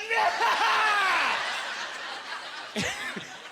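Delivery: high maniacal cackle; evil villain laugh. Real, not acted. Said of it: "a James Bond villain".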